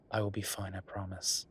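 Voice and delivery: ordinary talk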